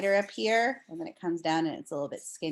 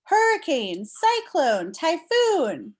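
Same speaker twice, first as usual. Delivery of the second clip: higher and louder, building with each name; dramatic storm names; 9 semitones higher, 6 dB louder, much slower than usual. Acted. Teacher thrilling the class with storm names, dramatic build. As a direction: announce each storm like a big reveal. Dramatic list in read-aloud.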